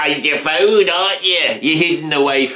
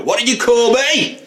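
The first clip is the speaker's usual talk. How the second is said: mock aggressive challenge; 10 semitones higher than usual. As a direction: play a bloke squaring up for a fight. Acted pub confrontation.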